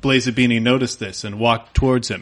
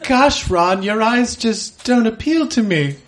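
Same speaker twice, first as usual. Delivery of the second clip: Hermione voice; 6 semitones higher than usual. Acted. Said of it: "Hermione speaking".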